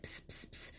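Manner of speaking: unvoiced sneaky psst calls; boy calling auntie from around the corner, psst; whispered psst. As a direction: hiss the psst softly like a sneaking kid. Kid sneaking from room.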